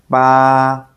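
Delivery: "Ba" held flat and steady; long level "Ba", like singing a note; first tone. Shown as a demonstration. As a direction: sustain "Ba" on one even note. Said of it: "keep your voice high and even".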